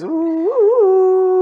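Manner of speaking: high hooting flute noise; flute sound. Shown as a demonstration. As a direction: hoot like a little flute. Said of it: "Fazer o som com a flautinha".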